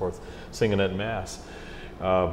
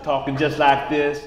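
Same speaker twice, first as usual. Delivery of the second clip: slow, higher Kentucky drawl; Kentucky accent; 6 semitones higher, 3 dB louder than usual. Retold or imitated. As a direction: imitate a drawn-out Kentucky accent. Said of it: "using his Kentucky accent".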